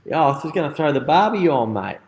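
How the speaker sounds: a jokey Aussie bloke firing up the barbie; mock Aussie. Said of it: "great Australian gags".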